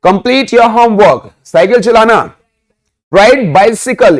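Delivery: ordinary talk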